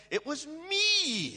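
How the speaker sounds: high, slow, drawn-out smug reveal; gloating grin. Retold or imitated. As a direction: stretch it out high, grinning. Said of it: "with a big smile on his face".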